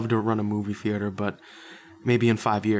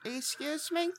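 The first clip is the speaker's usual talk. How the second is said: squeaky high character voice, softer; high put-on voice; 7 dB quieter, slower than usual. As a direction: squeak it again, quieter. Repeated in character.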